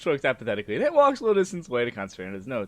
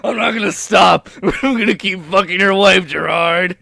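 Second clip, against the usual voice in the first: loud, raised-pitch mock defiance; defiant taunt; 3 semitones higher, 9 dB louder than usual. Caller refusing to stop taunting Gerard, loud defiance. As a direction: belt it out, stubborn and taunting.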